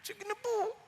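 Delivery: high, small, soft voice; acted sheepish submission; meek Moses. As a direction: give in timidly to an angry God. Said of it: "naggalit na siya. Sabi niya".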